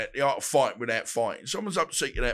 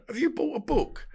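mock high voice; 12 semitones higher than usual. Mocking caller on phone.

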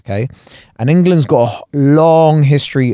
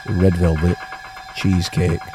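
northern voice; 5 semitones lower, 6 dB quieter than usual. Retold or imitated. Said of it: "[name] has gone northern now".

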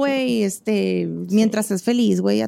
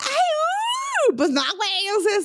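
exasperated; 9 semitones higher than usual. Real, not acted. Exasperated squeal.

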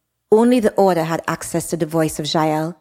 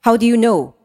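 louder, insistent challenge; pressing; 5 semitones higher, 5 dB louder than usual. Acted. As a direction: push her for the truth. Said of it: "Vlad pressed".

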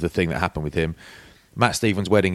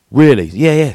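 higher and louder, eager disbelief then eager yes; delighted cabbie; 6 semitones higher, 8 dB louder than usual. Retold or imitated. Cabbie thanking the speaker for a coffee, excited and loud. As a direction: play the cabbie thrilled at a free coffee.

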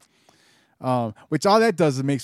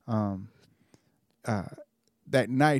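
ordinary delivery

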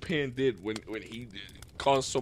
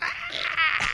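high laughing mock roar; Godzilla roar. Mocking how Godzilla sounds, laughing roar. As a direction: let out a squeaky monster shriek through laughter. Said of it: "he goes".

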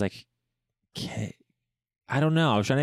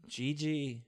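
soft try-out; 3 semitones higher, 11 dB quieter than usual. Shown as a demonstration. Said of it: "Gigi trying out name".

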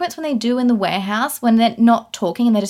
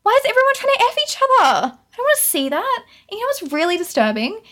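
high-pitched, incredulous complaint; exasperated outburst; 10 semitones higher than usual. Real, not acted. Reviewer griping that the film is too sexual, exasperated. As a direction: protest it at a much higher pitch.

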